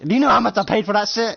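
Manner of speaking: high-pitched indignant rant; irate outburst. Acted. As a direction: demand it in exasperated outrage. Acted quarrel over the lawn.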